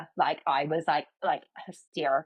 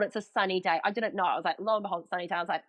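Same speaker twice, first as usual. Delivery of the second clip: ordinary talk, nothing marked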